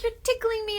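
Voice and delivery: put-on high childlike protest; little son voice. Boy protesting to mommy, high child voice. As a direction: whine it like a small boy. Son's voice in tickle story.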